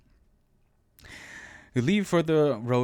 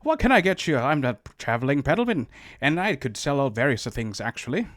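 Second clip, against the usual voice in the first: loud leprechaun patter, easing off; leprechaun peddler; 3 semitones higher, 5 dB louder than usual. Acted. Traveling peddler offers his goods, leprechaun voice. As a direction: hawk wares like a stage leprechaun. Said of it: "He was supposed to be a leprechaun".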